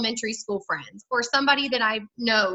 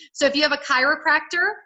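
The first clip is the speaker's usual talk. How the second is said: ordinary delivery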